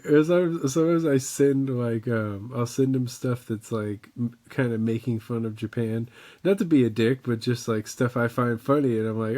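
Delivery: ordinary delivery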